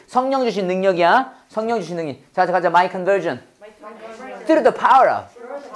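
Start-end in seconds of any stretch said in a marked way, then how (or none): 4.5-5.7 "Through the power of" drawn out, higher; slow, careful "Through the power of"; slow model phrase. Shown as a demonstration. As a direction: stretch "Through the power of" slowly. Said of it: "여기 한 번에 빨리 읽어봐".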